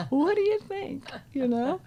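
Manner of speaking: high-pitched teasing question; playful teasing. Acted. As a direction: tease with a laugh close by. Teasing reply to students.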